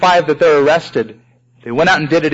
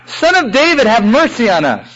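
pleading call, pitched much higher; crying out; 6 semitones higher than usual. Retold or imitated. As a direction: cry out for mercy from the roadside. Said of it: "the two men cried out".

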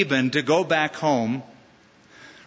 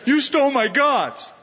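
high, loud mock complaint; mock angry outcry; 8 semitones higher, 3 dB louder than usual. Preacher mocks Laban crying over stolen gods, loud and high. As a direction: ham up a wronged man's wail.

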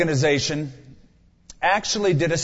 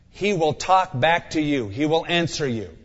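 normal talking voice

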